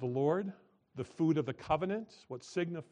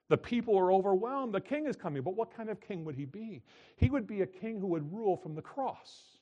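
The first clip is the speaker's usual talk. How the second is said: rising preaching; 7 semitones higher, 5 dB louder than usual. Acted. Preaching climax.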